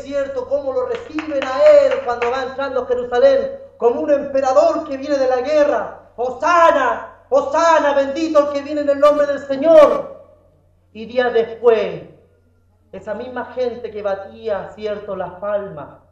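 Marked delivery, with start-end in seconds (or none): 6.2-10.2 acclaim a king like a cheering crowd; aclamación de multitud. Retold or imitated. Multitud que aclama a Jesús. (aclamación en voz alta de una multitud)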